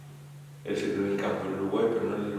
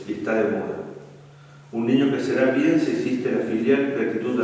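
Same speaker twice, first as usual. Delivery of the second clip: louder, weighty reading of a book passage; emphatic reading aloud; 2 semitones higher, 6 dB louder than usual. Acted. Priest reading a book passage to young couples, loud and emphatic. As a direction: read it out like a lesson to the youth.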